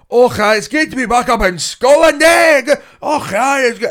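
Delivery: high, loud hammed-up Scottish bellow; shouty Scottish accent. Comic mocking another act's opening, shouty Scottish accent. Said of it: "he just did a shouty Scottish accent".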